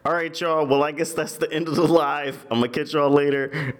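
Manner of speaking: high, casual influencer farewell; streamer sign-off. Acted. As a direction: sign off to your viewers in a lifted voice. Streamer signing off the live.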